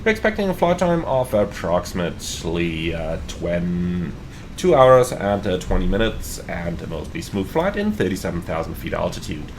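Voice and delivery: pilot PA announcement; captain announcement. Acted. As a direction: welcome the passengers as captain. Captain welcome announcement to passengers.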